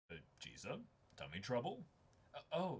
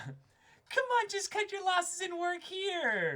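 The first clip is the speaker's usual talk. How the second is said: much higher, loud coaxing, slow; high pushy boss; 7 dB louder, slower than usual. Acted. Boss urging the student to quit college, high and coaxing. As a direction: sell the job hard.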